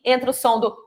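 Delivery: ordinary talk, nothing marked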